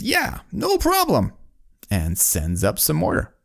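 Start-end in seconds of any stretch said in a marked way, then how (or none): 0.0-1.3 very high, easygoing call back; worker on the ground. Acted. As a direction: answer up to the tower in a high voice. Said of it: "the guy on the ground says".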